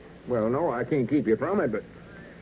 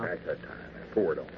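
hushed urging; 7 semitones lower, 7 dB quieter, faster than usual. Acted. Whispered encouragement beside the phone.

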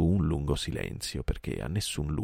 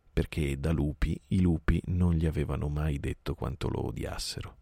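ordinary delivery

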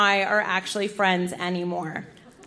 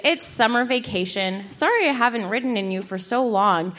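usual speaking voice

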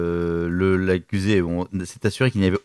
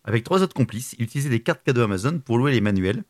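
normal talking voice

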